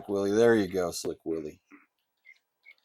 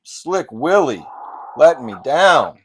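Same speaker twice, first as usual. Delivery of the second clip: loud, high groan of letdown at a teammate; frustrated shout; 6 semitones higher, 15 dB louder, slower than usual. Real, not acted. Gamer yells at his player Slick Willie, frustrated shout. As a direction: yell at the screen as the player blows it.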